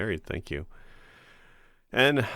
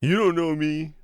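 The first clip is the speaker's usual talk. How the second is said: higher, louder teasing echo; mock dramatic echo; 7 semitones higher, 4 dB louder than usual. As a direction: mimic it in a put-on voice. Echoing in put-on voice.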